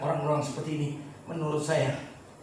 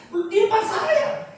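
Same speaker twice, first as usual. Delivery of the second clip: excited exclaim; 6 dB louder, slower than usual. Real, not acted. Excited punchline about models.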